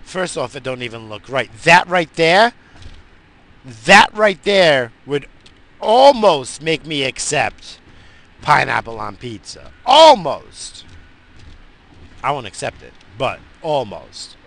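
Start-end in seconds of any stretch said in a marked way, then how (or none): 1.6-10.8 louder, slow, drawn-out insistence; emphatic outburst. Real, not acted. Ranting about kiwi on pizza, loud and drawn out. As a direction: lean on every word like it's outrageous.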